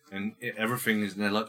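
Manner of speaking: usual speaking voice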